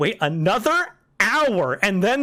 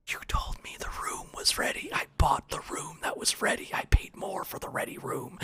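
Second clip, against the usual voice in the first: whisper; 10 dB quieter than usual. Retold or imitated. Angry complaint to hotel desk.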